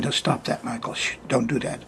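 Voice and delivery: put-on soft whispered shush to a small child; hushed warning. Father shushing his three-year-old away from the chart, whispered. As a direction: whisper it, trying to keep him away gently. Retold father hushing toddler.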